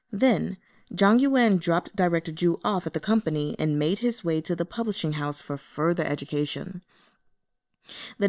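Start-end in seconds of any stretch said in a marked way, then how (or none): none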